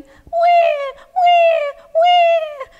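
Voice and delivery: very high, loud mock baby wails; babies crying. Storyteller imitating babies that night, wailing. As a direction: squeal like howling babies. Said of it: "What were the babies doing".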